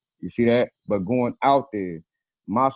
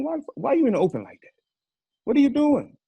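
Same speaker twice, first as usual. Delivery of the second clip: high, fast, exasperated demands; high heated scolding; angry retold questioning; 14 semitones higher than usual. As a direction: scold him down the phone. Angry phone call retold.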